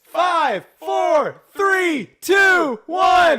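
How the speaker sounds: rising countdown, building to shouts; new year countdown crowd. Real, not acted.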